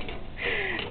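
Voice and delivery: quick laugh. Real, not acted. Finds the iron funny.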